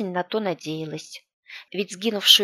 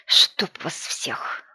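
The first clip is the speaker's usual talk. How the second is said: slow, low, breathy curse; cursing under breath; 3 semitones lower, much slower than usual. A narrator's reading. Man curses lost phone signal.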